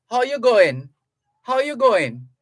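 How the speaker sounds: high, loud Trinidad lilt; Trinidadian greeting. Retold or imitated. Host imitating how people in Trinidad greet, Trinidadian lilt. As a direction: call it out like a Trinidadian. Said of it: "They ask".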